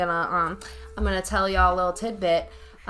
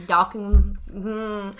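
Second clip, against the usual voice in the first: ordinary talk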